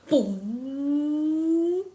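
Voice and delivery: long drawn-out boom, rising; imitated impact. Game master sounding the tray hitting the net, imitated boom. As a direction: stretch out a ringing boom.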